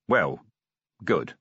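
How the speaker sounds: grumpy concession. A narrator's reading. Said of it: "Vimes conceded grumpily".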